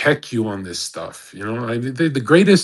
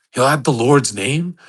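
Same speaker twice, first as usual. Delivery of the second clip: normal talking voice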